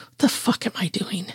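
high, whispery whimper; frightened whisper to yourself. A narrator's reading. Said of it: "you whimpered".

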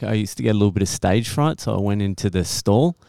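usual speaking voice

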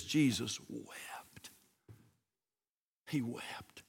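slow, reverent whisper; barely voiced, sorrowful hush. A narrator's reading. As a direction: whisper it solemnly, drawing it out. Solemn shortest verse.

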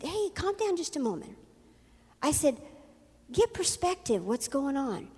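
soft, hushed appeal to calm down; soothing. Retold or imitated. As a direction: talk someone down quietly. Calming the screaming woman.

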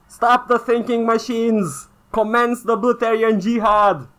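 high, slow, grandiose proclamation; mock battle cry. Answering the corporate statement with a call to jihad, mock-epic cry.

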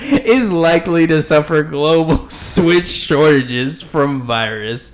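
slow, reading while still laughing; fighting back giggles as you read; chuckling through it. Real, not acted. Host reading the headline while still chuckling, laughing through words. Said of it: "I'm still chuckling a little bit".

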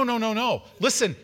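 ordinary talk

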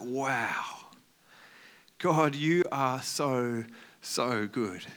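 soft, slow, hushed wonder; awed prayer. Retold or imitated. Elijah in awe after the miracles, soft and slow. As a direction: breathe it out in awe.

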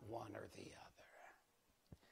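hushed whisper. Real, not acted. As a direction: whisper the punchline. Punchline dropped to whisper.

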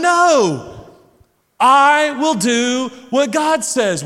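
high, loud, stretched-out proclamation; yelling. Retold or imitated. Dad and boys yelling the line at bedtime. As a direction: yell it slowly like a family chant. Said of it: "but we're yelling".

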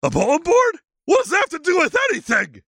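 high dopey creature voice; goofy high-pitched monster; Sasquatch voice. Acted. Sasquatch character voice.

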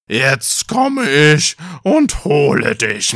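drawn-out growling troll voice; troll calling out. A narrator's reading. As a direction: stretch it out like a hungry troll. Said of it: "Da rief der Troll wieder".

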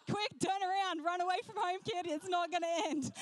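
high, urgent comic warning; mock panicked warning. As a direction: warn the kid off in hammed-up desperation. Mock warning to the crying child.

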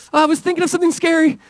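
high-pitched imitation of a little boy; scared young son. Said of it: "he's scared".

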